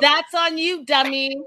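mock wife jeering; taunting wife. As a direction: rub it in like a scornful ex.

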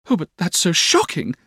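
put-on high girlish dismay; Dora's cry. A narrator's reading. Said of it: "cried Dora".

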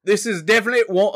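high, quick mock-British; attempted British accent. Shown as a demonstration. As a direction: start a British accent and falter. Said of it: "I can't do ac".

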